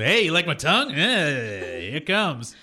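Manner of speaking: hammed-up, teasing come-on; joking impression of an over-familiar host; mock creepy owner. Joke version of owner making out.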